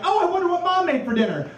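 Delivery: put-on high, bright kid voice coming home; high kid voice hoping for a good dinner; hopeful kid. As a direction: play a cheerful kid wondering about dinner.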